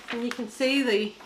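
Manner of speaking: ordinary talk, nothing marked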